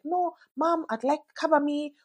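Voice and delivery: put-on high, whiny little-kid voice; toddler at bedtime. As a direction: play the child who won't sleep. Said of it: "that's the time they'll be like".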